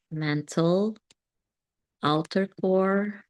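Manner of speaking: normal talking voice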